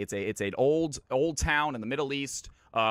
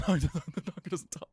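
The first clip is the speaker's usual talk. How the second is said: slower, laugh-filled punchline; laughing repeat; 4 semitones higher than usual. Real, not acted. Joke teller repeating his punchline to listeners, laughing. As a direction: repeat it slowly through laughter.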